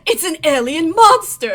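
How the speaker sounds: loud put-on snarl, strange voice. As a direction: snarl it at the monster. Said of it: "Lum snarls".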